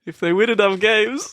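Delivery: high, laughing tease; amused. Real, not acted. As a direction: say it with a grin. Teasing repeat, laughs after.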